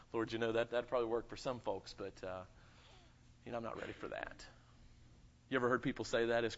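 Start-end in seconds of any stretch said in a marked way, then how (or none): 0.0-4.5 reluctant excuse. Retold or imitated. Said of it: "we say".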